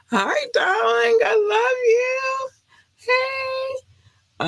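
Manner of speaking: high, slow gushing greeting; thrilled high-pitched hello. Real, not acted. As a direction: coo at her in a tiny high voice. Greeting goddaughter again.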